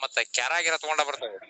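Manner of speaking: ordinary talk, nothing marked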